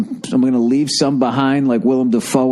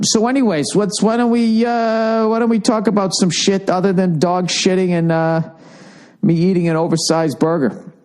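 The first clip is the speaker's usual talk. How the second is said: put-on voice; 10 semitones higher than usual. Raised, odd voice.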